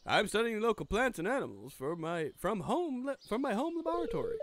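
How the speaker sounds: put-on lightly English-accented scientist. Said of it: "Wait, I'm going English".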